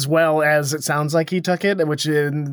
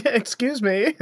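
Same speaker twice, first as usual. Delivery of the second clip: mock polite old man; 8 semitones higher, slower than usual. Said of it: "raising his hand like he has a question".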